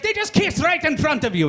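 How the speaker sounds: put-on high, loud accented outburst; mock yelling. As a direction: yell it as the outraged Ethiopian cab driver. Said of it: "But he's just yelling".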